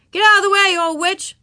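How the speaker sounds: high, loud order; yelling boy. A narrator's reading. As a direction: shout someone out of your path. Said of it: "Eric Garr yelled at Ramona".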